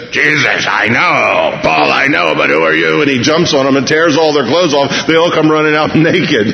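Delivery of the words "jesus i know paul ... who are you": demon voice. Acted. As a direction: answer as a taunting demon. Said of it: "The demon turns around and says".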